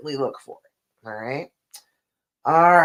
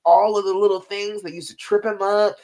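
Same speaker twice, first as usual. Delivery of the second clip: ordinary talk, nothing marked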